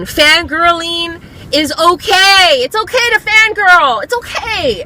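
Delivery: high, rising excited insistence; emphatic reassurance. Real, not acted. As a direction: insist on it, pitch climbing with each phrase.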